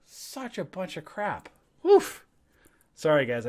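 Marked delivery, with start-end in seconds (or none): 1.8-2.2 a big frustrated blow of breath, high and loud; loud exasperated woof. Real, not acted. Trader venting to viewers after losing a trade, loud exasperated woof. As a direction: let out the whole bad day in one loud woof. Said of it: "I had to let that out".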